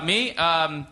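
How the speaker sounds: ordinary talk, nothing marked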